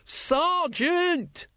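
very high, stretched warning cry; shouting. A narrator's reading. Carrot interrupts Colon's aim, shouting. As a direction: cry out in alarm, pitch way up. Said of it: "when you keep shouting".

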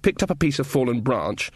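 usual speaking voice

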